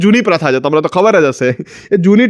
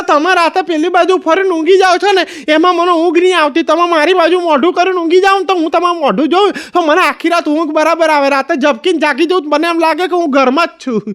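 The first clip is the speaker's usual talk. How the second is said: much higher, sweet wife voice; pleading wife; 8 semitones higher than usual. Retold or imitated. Wife sweetly asking her husband to sleep facing her, in a high voice. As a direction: coax him to face her at night.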